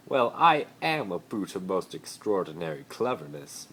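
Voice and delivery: loud, grand, self-important; pompous Malfoy. Acted. Malfoy boasting to Harry, pompous and loud. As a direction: boast with puffed-up pride. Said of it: "Malfoy replied".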